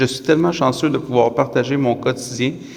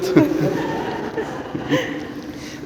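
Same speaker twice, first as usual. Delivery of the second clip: rire ému; 4 dB quieter than usual. Real, not acted. Pause émue pendant les vœux.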